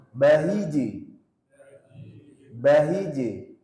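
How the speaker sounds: slow bahiji with the j pressed to the palate; jim with tongue on palate. Shown as a demonstration. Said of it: "menempel ke langit-langit atas".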